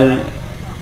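quiet airy laugh under the breath; hushed laugh at his own joke; soft breathy chuckle. Real, not acted. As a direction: let out a soft, almost silent chuckle. Monk jokes about aging hair.

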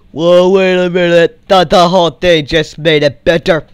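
loud, high burst of real amazement; excited realisation. Real, not acted. Builder seeing the house suddenly improved, loud real excitement. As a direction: exclaim it loudly, pitched right up.

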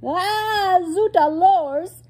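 very high, loud, drawn-out cry; exasperated yell. Acted. Dizzy waiter slips on fruit, exasperated yell. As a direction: yell it slowly in storybook exasperation. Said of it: "he yelled".

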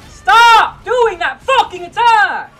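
loud, high, slow drawn-out scream; enraged gamer yelling at the boss; furious yell. Real, not acted. Boss repeats the attack.

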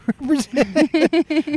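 words broken by a high burst of laughter; laughing through it. Real, not acted. Joking about Australians, laughing as she speaks. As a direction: laugh your way through the line.

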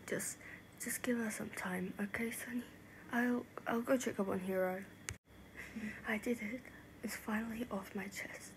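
soft, near-whispered and drained; subdued aftermath. Acted. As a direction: speak quietly after a shock. Shaken aftermath of the confession.

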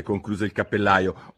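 usual speaking voice

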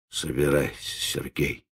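low, soft sullen grumble. Acted. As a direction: mutter it darkly. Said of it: "угрюмо проворчал Ладышкин".

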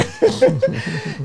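high burst of laughter. Real, not acted. Host laughing on air after the name exchange.

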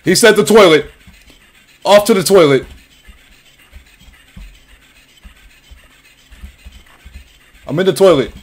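high, loud, frantic rush; panicked shouting. Real, not acted. Player fleeing the monster to the toilet, frantic shout. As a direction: scramble for safety at the top of your voice.

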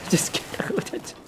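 breathy laugh through it; laughing whisper. Real, not acted. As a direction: giggle it out quietly.

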